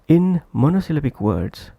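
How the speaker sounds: ordinary delivery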